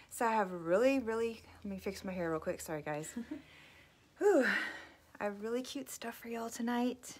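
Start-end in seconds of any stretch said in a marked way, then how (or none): 4.2-4.4 high, loud laugh into a relieved whew. Real, not acted. Flustered host laughs at herself, then a high loud whew.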